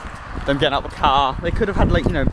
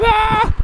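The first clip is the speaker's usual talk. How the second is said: high yelp into laughter; yelp then laugh. Real, not acted. Hit in the head, yelp and burst out laughing. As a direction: cry out then crack up laughing.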